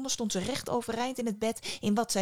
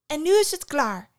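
loud, raised burst of impatience; exclaiming; 6 semitones higher, 9 dB louder than usual. A narrator's reading. Daughter cuts off her sobbing father, exclaiming. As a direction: cry out, fed up, pitch jumping up. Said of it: "riep ze uit".

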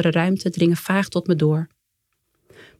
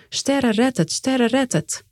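higher, insistent chant at normal volume; repeated mantra; 5 semitones higher, slower than usual. A narrator's reading. Father keeps repeating the mantra for his baby, insistent chant. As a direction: keep repeating it in a lifted pitch. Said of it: "hij blijft het maar herhalen".

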